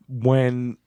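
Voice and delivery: ordinary talk, nothing marked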